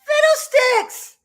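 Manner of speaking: high, loud mock exclamation; a high, silly substitute for swearing; minced oath. Real, not acted. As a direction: swap the curse for a silly word, shouted high. Swallowing a swear word.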